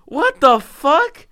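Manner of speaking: high, loud, slow outraged disbelief; mock disbelief. [name] replaying his reaction to the sleeper-build comment, in loud stunned disbelief. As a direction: stretch it out like you can't believe your ears. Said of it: "I'm like".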